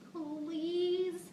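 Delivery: put-on high pleading little girl; begging child. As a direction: whine it like a kid.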